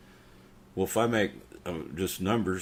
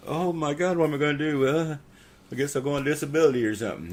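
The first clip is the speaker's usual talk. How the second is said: high-pitched imitation of helpless worry; mock panic; 8 semitones higher, 4 dB louder than usual. Man mocks voters who get the cancer, high mock panic. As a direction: mimic someone fretting, voice pitched well up. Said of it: "you can tell they get the cancer".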